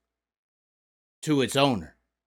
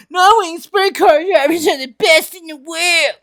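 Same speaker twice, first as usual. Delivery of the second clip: mock fan boast; 11 semitones higher than usual. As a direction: yell it like a proud fan. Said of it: "I hear these people".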